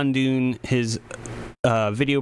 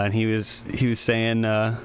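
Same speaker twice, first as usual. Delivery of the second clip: ordinary delivery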